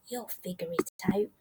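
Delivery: hushed, quick bedtime reassurance; soft, low and quickly murmured; whispered. A narrator's reading. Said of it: "They kissed her and whispered".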